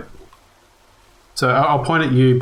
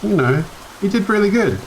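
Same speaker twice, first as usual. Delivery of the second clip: high reassuring character voice; 7 semitones higher than usual. Acted. Character reassures bodyswapped friend.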